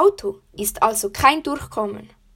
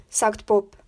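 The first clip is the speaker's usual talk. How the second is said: usual speaking voice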